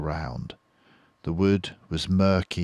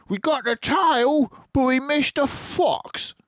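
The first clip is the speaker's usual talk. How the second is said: high, loud disappointed farmer voice; Bean the farmer; 5 dB louder than usual. Acted. Bean tossing the tail away, high and disgruntled. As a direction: grumble about the miss in Bean's voice. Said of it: "he said".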